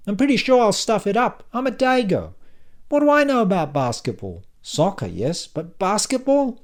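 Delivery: high, loud dinner-table chatter; Silvio, excitable talker. Acted. Silvio rattles on to dinner guests about basketball, high and loud.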